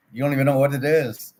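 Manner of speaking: heated retort. Retold or imitated. Arguing with the banker.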